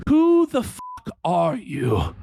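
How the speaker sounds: a lightly voiced angry deity; Odin voice. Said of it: "said to Rudyard".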